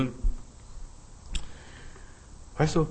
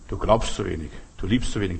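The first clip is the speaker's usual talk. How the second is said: lower-pitched mock comforter's verdict; reproaching comforters; 6 semitones lower than usual. Job's comforters sitting there judging him, low mock reproach. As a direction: pass judgement in a low voice.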